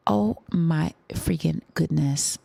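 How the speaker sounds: ordinary delivery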